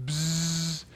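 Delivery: short buzz through the lips; bee buzz again. Shown as a demonstration. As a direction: spell it out with a buzz. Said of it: "Spell that for me".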